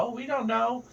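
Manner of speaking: high-pitched feigned innocence; mock innocence. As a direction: play the clueless kid in a high voice. Kids playing innocent to questioners.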